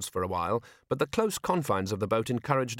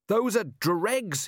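high, drawn-out call without full volume; mild narrated shout; 11 semitones higher, much slower than usual. A narrator's reading. Carrot answers the ship's captain, mildly shouted. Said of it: "he shouted".

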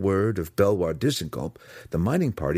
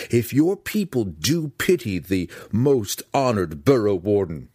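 raised voice, higher and impassioned; half-yelled, earnest rebuke; heated exhortation; 5 semitones higher than usual. A narrator's reading. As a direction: urge him forcefully, building in pitch. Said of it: "Drizzt yelled at him".

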